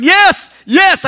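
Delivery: high, loud cries of "yes"; crying out yes. Retold or imitated. Preacher reliving his answer to God, crying out loud. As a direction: cry out yes, overwhelmed. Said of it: "I was getting louder".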